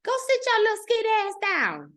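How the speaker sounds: high-pitched mock scolding; scolding elder. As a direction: imitate someone telling a child off. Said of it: "somebody told you".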